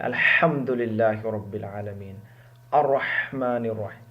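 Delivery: slow careful Arabic recitation of "الرحمن الرحیم". Shown as a demonstration. Teacher recites the verse as a model, slow and careful. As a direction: recite slowly with a clear breathy ha in "الرحمن". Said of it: "اس کو ہم شروع کرتے ہیں".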